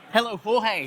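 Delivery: ordinary talk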